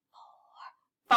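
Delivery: breathy unvoiced number; whispered count. Shown as a demonstration. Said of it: "Let's try whispering the counts this time".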